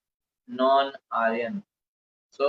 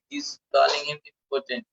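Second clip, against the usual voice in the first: ordinary talk, nothing marked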